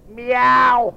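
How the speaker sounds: a cat's meow from the cow; a playful cat meow for the children. Acted. Cow answers the doctor.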